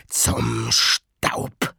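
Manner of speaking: rauher, drängender Geisterbefehl; harter Befehl. A narrator's reading. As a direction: zischend antreiben, Junge laut. Garrick drängt harsch. (Garrick herrscht Riley an)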